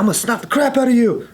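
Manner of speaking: high mock threat; retold angry threat. Aggressor threatening the man who stepped in, angry. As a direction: imitate an aggressor squaring up.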